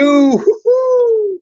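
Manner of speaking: sudden high-pitched burst of laughter; giddy high laugh at his own catchphrase. Real, not acted. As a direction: let a bright squeal of a laugh escape. Host laughs after catchphrase.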